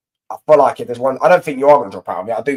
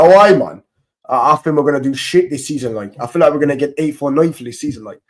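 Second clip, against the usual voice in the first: imitating a friendly Geordie fan; Geordie impression; 2 semitones higher, 4 dB louder than usual. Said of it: "And he's like".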